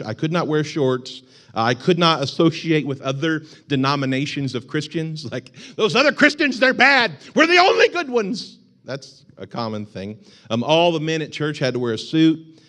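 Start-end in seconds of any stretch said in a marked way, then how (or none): 5.8-8.6 high, loud smug voice; hammed-up holier-than-thou sneer; mock self-righteous churchgoer. Mocking people who think their church is the only good one, high and smug.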